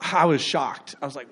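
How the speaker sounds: usual speaking voice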